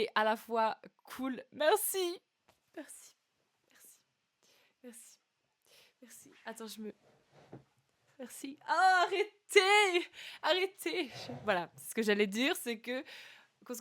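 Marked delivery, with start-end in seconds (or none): 2.8-8.6 fading thanks. Real, not acted. Repeated thanks fading away. 8.7-11.1 flattered protest. Real, not acted. Embarrassed by chat praise.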